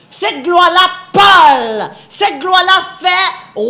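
loud, slow, swelling sermon build; preaching at full force. Acted. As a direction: hammer out each phrase to the congregation. (prédication enflammée, lente et forte)

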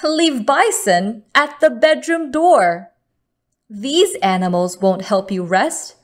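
usual speaking voice

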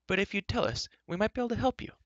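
high-pitched character voice; high, mildly impatient offer; Elenwy, offering help. A narrator's reading.

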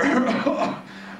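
evil laugh. Acted. Reaper's villain laugh.

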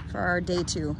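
usual speaking voice

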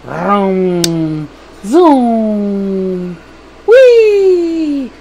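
loud drawn-out zoomy sound effects; whooshing kid-style airplane play; playful flying noises. Pretend. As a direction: play at flying, long swooping cries. Imitating a flying thing's noises.

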